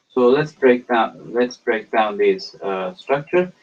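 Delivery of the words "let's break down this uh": ordinary delivery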